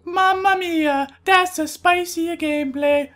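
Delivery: high-pitched, drawn-out cartoon Italian accent; worst Italian accent. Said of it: "the worst Italian accent".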